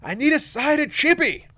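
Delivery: high, loud call into the kitchen. Retold or imitated. Server calling an order into the kitchen, high and loud. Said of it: "poke her head into the kitchen and yell out".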